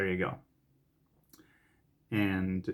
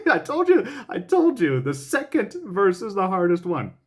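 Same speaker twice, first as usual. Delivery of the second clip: high laughing "I told you"; 10 semitones higher, 6 dB louder than usual. Real, not acted. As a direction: laugh it out, caught out.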